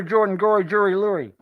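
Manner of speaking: "Gory Jury Lurie" as three separate rhyming names; pronunciation shown. Shown as a demonstration. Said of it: "I'll tell you how to say it".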